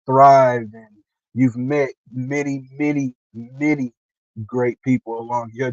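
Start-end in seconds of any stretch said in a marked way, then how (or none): none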